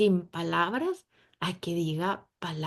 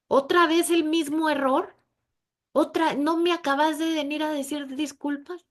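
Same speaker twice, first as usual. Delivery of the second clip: high, loud, fed-up scolding; exasperated scolding; 8 semitones higher, 5 dB louder than usual. Pretend. Imagined God scolding a repeat sinner, exasperated and shrill-high. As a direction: scold like an impatient parent. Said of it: "Imagínense que Dios nos dijera eso".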